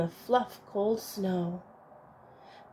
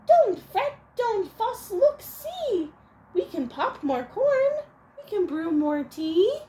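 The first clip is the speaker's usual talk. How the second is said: much higher, tiny squeaking mouse voice; squeaky mouse; 11 semitones higher, 3 dB louder than usual. Acted. Mouse soothes the weeping bear, high squeaky voice. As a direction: squeak it like a little mouse comforting a friend. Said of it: "Mouse squeaks".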